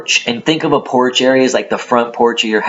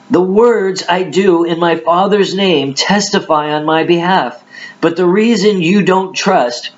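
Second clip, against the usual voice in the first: raised, emphatic Bible reading voice; dramatic scripture reading; 7 semitones higher than usual. Acted. Preacher reads Jesus answering the Judeans, dramatic scripture reading. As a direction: declaim the verses at a higher pitch.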